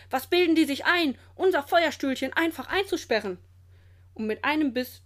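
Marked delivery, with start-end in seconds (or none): 0.1-3.4 loud, higher, outraged question; indignant growling. A narrator's reading. Grandpa protests his dragon being locked up, indignant growling. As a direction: fume at the cheek of it. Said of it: "knurrt Olchi Opa".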